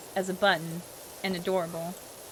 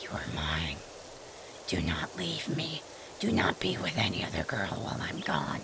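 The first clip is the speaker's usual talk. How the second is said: creepy yandere whisper; 6 dB quieter than usual. Retold or imitated. Voicing a yandere's letter in a creepy whisper. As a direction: whisper it like an obsessed lover. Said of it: "I don't know why I gave her that voice".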